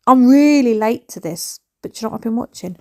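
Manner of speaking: ordinary delivery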